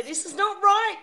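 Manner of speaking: high, drawn-out outcry of objection; indignant protest. Acted. Imagined audience member objecting to the show, indignant outcry. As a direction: stand up and object, pitched high. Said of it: "You would stand up and you'd say".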